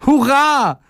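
very high, gleeful cheer; high, joyful call; jubilant shout. A narrator's reading. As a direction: shout hooray in a little character's voice. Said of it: "rief der kleine Tag".

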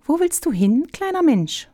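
calling out very high, like giant mountains; a chorus of mountains hailing a tiny traveller, high and strange; booming mountain voices. A narrator's reading. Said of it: "Mehrere Stimmen riefen".

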